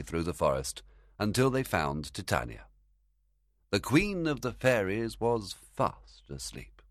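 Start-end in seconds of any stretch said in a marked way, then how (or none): none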